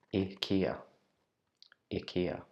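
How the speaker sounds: ikea repeated as ih-KAY-uh; ikea, short ih, stressed KAY; careful model of ikea; Swedish ikea. Shown as a demonstration. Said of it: "we should pronounce it".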